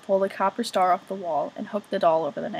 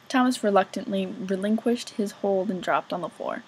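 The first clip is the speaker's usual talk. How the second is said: ordinary delivery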